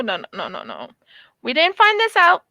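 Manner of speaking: normal talking voice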